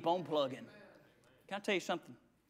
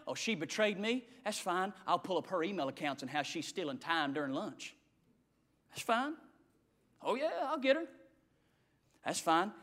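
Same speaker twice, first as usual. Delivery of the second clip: much softer, low scheming murmur; scheming under breath; 6 semitones lower, 12 dB quieter than usual. Retold or imitated. Preacher voicing a scheming coworker, softly scheming. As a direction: mutter the petty revenge plot under your breath. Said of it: "this is what we say".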